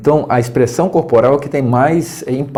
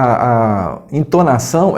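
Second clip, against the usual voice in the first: normal talking voice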